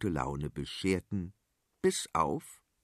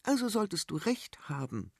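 put-on higher, patient young princess; level-headed princess; 8 semitones higher, 4 dB quieter than usual. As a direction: reason it out calmly, higher voice. Princess reasons with mother.

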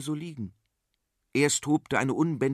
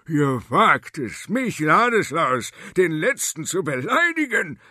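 thundering; 6 semitones higher, 7 dB louder than usual. Acted. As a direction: thunder it down on them. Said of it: "donnerte er". (donnernd, wütend)

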